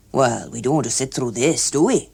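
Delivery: lightly voiced older man; a hint of a firm escort. A narrator's reading.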